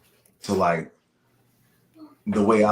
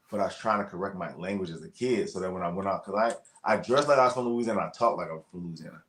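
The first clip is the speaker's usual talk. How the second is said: usual speaking voice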